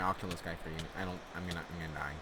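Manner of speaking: normal talking voice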